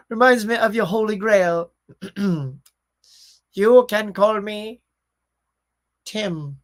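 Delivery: hammed-up British accent with dramatic pauses; British accent impression. Quoting Holy Grail to the stream, mock British accent. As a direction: put on a theatrical British accent, drawn out. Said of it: "attempt at your, uh, delightful accents".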